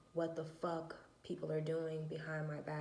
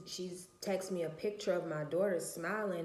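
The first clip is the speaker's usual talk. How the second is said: ordinary delivery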